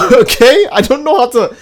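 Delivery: surprised. Real, not acted. Startled by a chat question.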